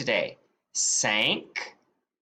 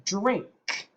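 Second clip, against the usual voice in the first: usual speaking voice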